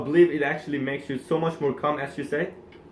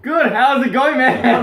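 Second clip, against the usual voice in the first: high, loud, laughing back; match his energy with a laugh; loud laughing reply; 10 semitones higher, 10 dB louder, slower than usual. Acted. Mimics loud energetic greeting.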